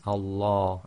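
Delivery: Allah slowly, with full-mouthed "law"; heavy L in Allah. Shown as a demonstration. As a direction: say Allah with the dark vowel of large. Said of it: "so be very careful".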